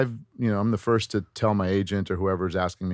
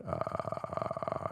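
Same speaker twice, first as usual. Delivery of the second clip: soft creaking glottal hits on a held sound; quiet drawn-out vocal fry; 12 dB quieter than usual. Shown as a demonstration. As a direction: let the air crackle slowly in the throat. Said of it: "glottal stop".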